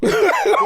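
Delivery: sudden shrieking laugh; high burst of laughter. Real, not acted. Friend's British joke lands, sudden shrieking laugh.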